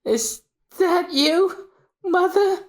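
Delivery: put-on high, slow child voice; a child's high, hesitant question; hopeful little girl. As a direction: ask it small and unsure. Little Robin sees her mother.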